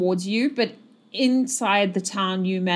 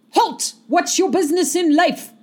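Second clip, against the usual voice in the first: high, raised challenge; guard's challenge shout; 8 semitones higher, 4 dB louder than usual. Acted. Nervous guard halts approaching travellers, shouting. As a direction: shout it like a sentry stopping strangers. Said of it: "They shout to you".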